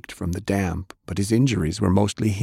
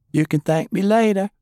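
put-on much higher, louder playful girl; cheeky Fay; 13 semitones higher, 4 dB louder than usual. A narrator's reading. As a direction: toss it off with a grin. Fay cheeky as she vanishes.

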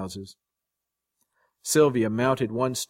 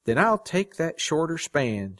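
firm, higher-pitched resolve; 6 semitones higher than usual. A narrator's reading. Woman firmly telling him her choice, firm. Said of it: "she said firmly".

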